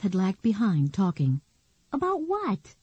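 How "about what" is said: put-on girl's voice. Nicky, the girl, asks.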